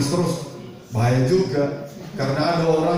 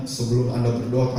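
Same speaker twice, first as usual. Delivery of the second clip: normal talking voice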